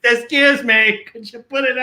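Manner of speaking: exasperated repeat. Retold or imitated. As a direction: ask again, losing patience. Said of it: "it's going all over".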